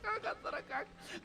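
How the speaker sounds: high, hushed, slow wail; a thin high keening for the dead uncle; mock wailing. Widow keening for her husband before the boy, high hushed wail. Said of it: "રોતા રોતા કીધા".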